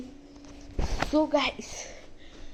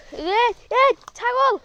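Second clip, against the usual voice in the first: high, slow, stretched yell at the goat; drawn-out high shout; calling out; 11 semitones higher, 10 dB louder, much slower than usual. Real, not acted. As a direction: call the goat from far away.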